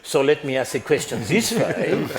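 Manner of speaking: slips into a German-sounding accent while laughing; laughing question that comes out German-sounding. Real, not acted. Moderator laughing at his own German-sounding accent. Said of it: "sorry, that sounded German too".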